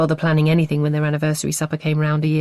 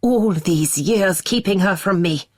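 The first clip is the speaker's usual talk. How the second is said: bitter slow accusation; 3 semitones higher than usual. Acted.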